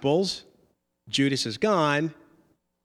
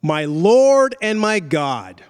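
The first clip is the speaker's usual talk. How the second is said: slow, loud exclaiming; acted-out cry of worship, stretched and strong; awed exclamation; 4 dB louder, slower than usual. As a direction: proclaim it with drawn-out weight. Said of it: "Thomas exclaims to Jesus".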